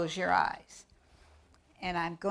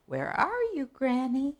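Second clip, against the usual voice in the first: put-on little girl calling; 6 semitones higher than usual. Little Red calls Granny.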